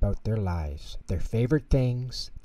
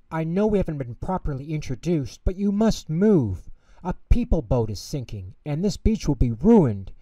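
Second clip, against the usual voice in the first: higher, fast pleading warning; urgent warning; 5 semitones higher, 4 dB louder than usual. Acted. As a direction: hurry her before the boat sinks. Penguin urgently warns flower.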